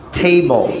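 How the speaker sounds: "টেবল" with a breathy t; native table. Shown as a demonstration. Teacher showing the native table sound. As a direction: aspirate the t in "টেবল". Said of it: "তারা বলছে".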